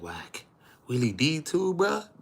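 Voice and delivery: high rising disbelief; disbelieving plea. Retold or imitated. Begging [name] not to expose [name], high disbelief. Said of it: "I said".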